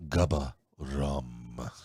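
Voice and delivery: put-on low, very slow Spanish-accented slogan. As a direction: draw out the brand name in a Spanish accent. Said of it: "do it in my Spanish accent".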